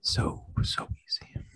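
breathy seductive whisper; sultry whisper. Acted.

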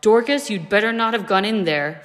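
loud, sharp warning yell. A narrator's reading. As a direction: shout it through the door. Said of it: "heard someone yell".

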